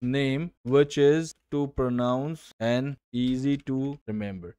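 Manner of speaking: very slow, word-by-word reading of a typed prompt; spelling out a ChatGPT prompt slowly; slow dictation. Shown as a demonstration. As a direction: dictate each word as if typing it. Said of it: "chat GPT में जाओगे और बोलोगे".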